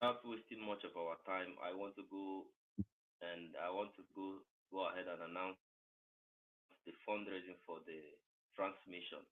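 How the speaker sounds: very quiet, barely audible announcement; voice too low. Real, not acted. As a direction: announce it far too softly. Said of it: "It's too low".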